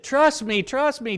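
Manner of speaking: high, loud coaxing call; coaxing cruel dad. Retold or imitated. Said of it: "if I had been a cruel dad and I said".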